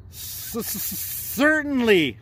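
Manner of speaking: high, stammering little squirrel voice; nervous squirrel with a stammer; stuttering squirrel. Acted. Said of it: "the squirrel replies".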